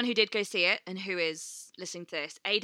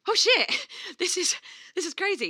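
high, loud re-enacted amazement; amazed realisation; 11 semitones higher, 6 dB louder than usual. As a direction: burst out laughing in mock disbelief. Retold moment of amazed realisation.

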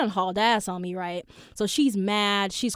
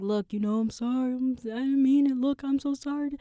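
pleading apology; 4 semitones higher than usual. Retold or imitated. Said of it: "I'm just leaving voicemails like".

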